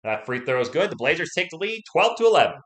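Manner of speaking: live play-by-play. Real, not acted. Streamer calls the score for viewers, rising play-by-play. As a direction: call the lead change, climbing in pitch and volume.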